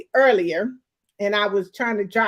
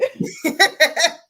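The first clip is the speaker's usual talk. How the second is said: laughing; 4 semitones higher, 5 dB louder than usual. Real, not acted. Laughs at teasing friend.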